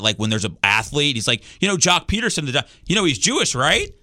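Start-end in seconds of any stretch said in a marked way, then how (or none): 1.6-3.9 higher, lightly voiced proud dad; pointing it out like a dad, checking you caught it; a hint of an eager dad, pitched up; imitating his dad. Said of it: "My dad's always been the kind of guy".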